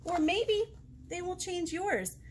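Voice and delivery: lilting read-aloud with big rises; storybook voice for little ones; sing-song picture book reading; reading to children. Acted.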